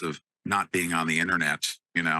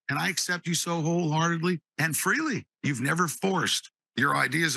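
emotional prayer; 7 semitones higher than usual. Retold or imitated. Emotional retold prayer in jail.

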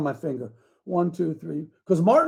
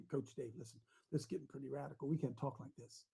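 hushed aside; 6 semitones lower, 22 dB quieter, faster than usual. Retold or imitated. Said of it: "call me over in the corner and say".

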